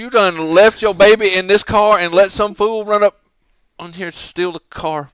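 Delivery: loud, high mock scolding, easing at the end; berating car thief. Car thief berating mother for leaving her baby, mock angry rant. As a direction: chew her out in hammed-up outrage. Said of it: "gives her a serious ration of shit".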